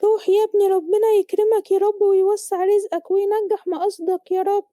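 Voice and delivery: high, fervent motherly prayer; mother blessing son. Acted. Mother praying for her working son, high motherly voice.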